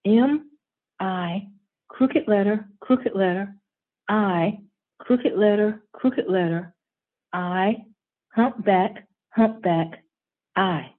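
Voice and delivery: spelled out slowly letter by letter; slowly spelling. Acted. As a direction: spell it out deliberately. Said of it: "Derek slowly spells".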